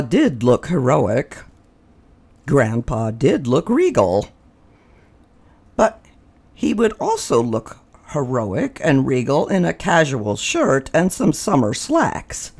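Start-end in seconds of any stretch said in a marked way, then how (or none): none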